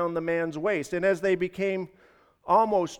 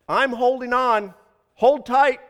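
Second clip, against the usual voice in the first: louder, higher urgent reply; raised, pitched-up call; calling up from below; 8 semitones higher, 7 dB louder than usual. A narrator's reading. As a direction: shout it up from the end of a rope. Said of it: "called out to the dangling man".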